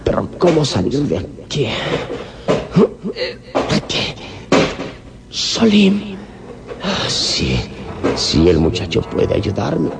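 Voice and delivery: fighting for air in panic; panicked and breathless. Acted.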